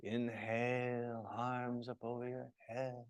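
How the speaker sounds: limp, sleepy yoga instruction; low-energy cue. Shown as a demonstration. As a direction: cue the class with no energy at all. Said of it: "you can't just be like".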